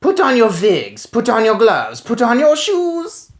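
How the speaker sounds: high, loud barked witch orders; urgent witch commands; commanding witch. Acted. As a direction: drive them to hurry like a witch.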